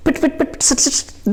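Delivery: light, high pit-pit patter; quick, barely voiced rain noises; imitated raindrops. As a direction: tap out raindrops with crisp little syllables. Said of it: "different, different sounds".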